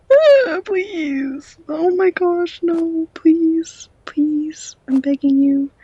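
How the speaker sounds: high, hammed-up begging whine; mock pleading. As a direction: beg dramatically in a high voice. Begging for item back on.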